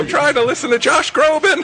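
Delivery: put-on high, loud cranky old lady; mock old mother. Old mother yelling at her son, high mock voice. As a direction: whine it like an annoyed grandma.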